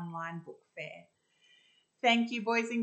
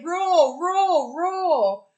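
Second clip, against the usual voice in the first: loud, high repeated roars; bear roars; 11 semitones higher, 15 dB louder, much slower than usual. Acted. The noisy bear singing into the microphone, loud roars. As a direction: keep roaring, falling each time. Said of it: "went the noisy bear".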